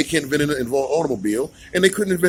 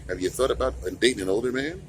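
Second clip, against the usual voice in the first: asking someone out; 3 semitones lower, 8 dB quieter than usual. Retold or imitated. Mocking asking women out.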